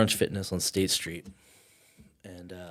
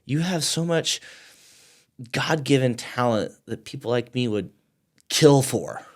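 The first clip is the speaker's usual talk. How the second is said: higher and louder, a retold furious rant; angry friend; 6 semitones higher, 7 dB louder than usual. Friend scolding him for quitting golf, angry and loud. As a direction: let the disappointment boil over into scolding. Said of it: "this guy is, like, pissed".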